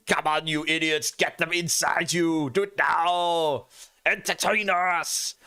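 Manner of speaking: slow, sneering villain taunt; a gloating overlord watching. Pretend. Voicing Timmy taunting the eater.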